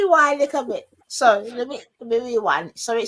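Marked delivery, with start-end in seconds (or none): none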